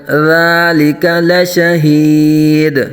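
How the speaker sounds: slow melodic tajweed chant; Quran recitation. Shown as a demonstration.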